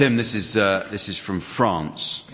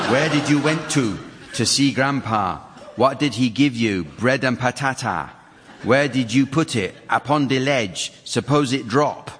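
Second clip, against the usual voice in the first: higher, lilting attempt at an Antiguan accent; put-on Antiguan accent; 3 semitones higher than usual. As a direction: recite the rhyme with an imitated Antiguan accent. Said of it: "you're not having some stroke or anything".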